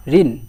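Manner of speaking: ঋণ ending firmly on the n; no final vowel. Shown as a demonstration. Teacher modelling for students to repeat, closed final n. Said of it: "উচ্চারণ করো".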